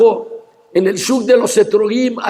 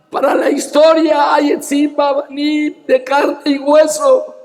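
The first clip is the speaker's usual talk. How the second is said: raised, ringing sermon voice with shouted peaks; fiery preaching; 3 semitones higher than usual. Acted. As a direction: preach it to the crowd, full force. Preaching at full force. (predicación encendida)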